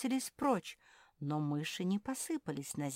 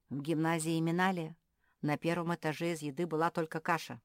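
usual speaking voice

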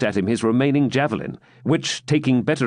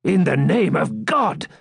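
higher, slow alarmed swineherd; 7 semitones higher, slower than usual. A narrator's reading. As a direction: gasp it out in alarm, slowly. Swineherd voiced in story.

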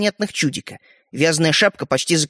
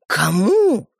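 astonished, insistent question; shocked demand; 3 semitones higher, 4 dB louder than usual. Acted. Brothers asking who got the toy, shocked. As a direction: demand it in disbelief.

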